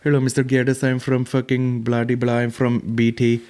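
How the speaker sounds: put-on Indian call center accent; mock Indian accent. Call center worker ringing dad, mock Indian accent. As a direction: read it like a caricatured Indian phone agent. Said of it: "they would ring up and be like".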